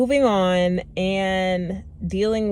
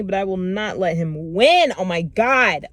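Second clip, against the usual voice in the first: usual speaking voice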